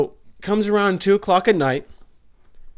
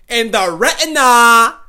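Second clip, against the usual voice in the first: loud, higher, slower yell; triumphant shout of the gory detail; excited shout; 6 semitones higher, 8 dB louder, slower than usual. Acted. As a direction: belt it out at the punchline. Climax of his stabbing story.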